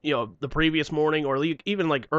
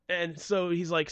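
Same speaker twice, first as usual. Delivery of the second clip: ordinary talk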